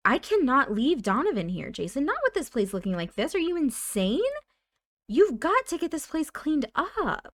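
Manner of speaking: high, emphatic acted telling-off; exasperated scolding. Nancy refusing to leave her son there, exasperated. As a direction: scold him with rising exasperation.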